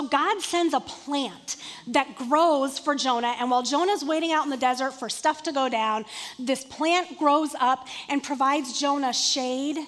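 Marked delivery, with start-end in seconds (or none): none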